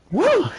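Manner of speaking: sudden high falsetto woo; a real celebratory whoop; excited whoop. Real, not acted. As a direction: let out a whoop of delight. Cheering the finish.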